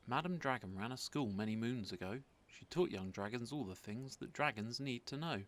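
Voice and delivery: usual speaking voice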